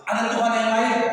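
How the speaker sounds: mock yell up from the cliff; comic shout. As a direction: call out hopefully for someone else. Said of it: "Lalu dia teriak".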